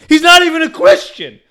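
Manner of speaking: very high incredulous squeal; high-pitched disbelief; indignant aside. Real, not acted. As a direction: exclaim it in comic outrage. Indignant aside about passenger.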